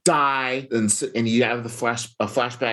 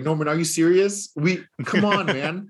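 high, disbelieving plea, acted out; mock indignant appeal, pitched up; incredulous protest; 7 semitones higher than usual. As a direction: play an exasperated, pleading hero. Pleading with villain who betrayed him.

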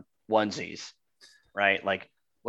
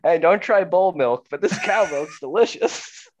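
mock discoverer; 7 semitones higher, 5 dB louder than usual. Imitating the first milk drinker.

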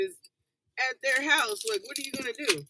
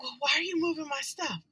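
irritated, higher-pitched complaint; annoyed spouse; 5 semitones higher than usual. Retold or imitated. Imitated spouse quarrel.

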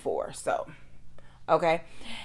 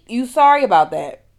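higher and louder, mocking disbelief; incredulous mockery; 6 semitones higher, 9 dB louder than usual. Patient mocks the doctor's late apology, incredulous. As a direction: throw his apology back at him. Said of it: "I'm thinking".

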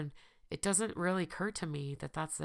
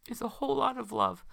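tearful sick voice; 5 semitones higher, 5 dB louder than usual. Real, not acted. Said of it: "with my crazy sick voice and my tears".

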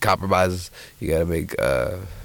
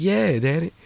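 high-pitched, lightly acted little boy; earnest young son; 10 semitones higher, slower than usual. Boy answering his daddy, earnest and high. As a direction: answer up high like a kid to dad.

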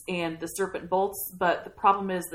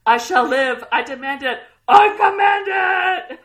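building from loud to a much higher, louder shout; yelling defiance; 11 semitones higher, 14 dB louder than usual. Retold or imitated. Kenneth yelling at the raging sea, building to a shout. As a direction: roar it into the storm, building. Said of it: "he just yells".

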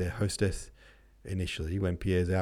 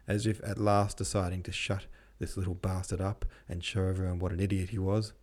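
ordinary delivery

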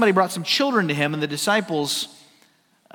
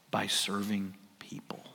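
whispered answer; 9 semitones lower, 13 dB quieter than usual. Shown as a demonstration. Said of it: "True greatness serves God by serving people".

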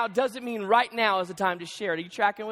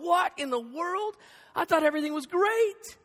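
mock baffled; 7 semitones higher than usual. Partner blindsided by a laundry list, mock baffled. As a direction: play someone blindsided, higher pitch. Listener hit with complaint list.

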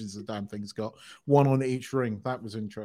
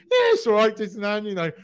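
normal talking voice

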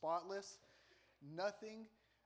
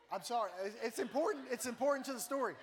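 higher, louder defensive protest; protesting apology; 6 semitones higher, 6 dB louder than usual. Real, not acted. Preacher defending his joke to the audience, defensive. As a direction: insist through the crowd's reaction.

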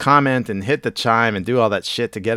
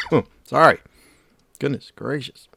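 ordinary talk, nothing marked

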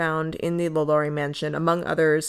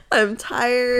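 mock crying; 5 semitones higher than usual. Real, not acted. Laughing fit, exhausted.

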